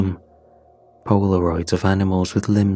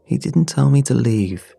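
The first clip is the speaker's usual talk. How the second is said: ordinary delivery, nothing marked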